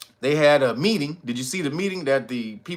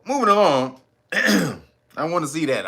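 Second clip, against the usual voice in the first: ordinary delivery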